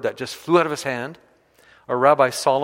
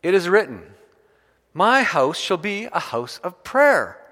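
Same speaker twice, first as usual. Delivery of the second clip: raised, ringing proclamation of a quote; loud voice; 5 semitones higher, 5 dB louder than usual. Retold or imitated. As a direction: proclaim it loudly and higher, stretching the key words. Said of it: "then with a loud voice".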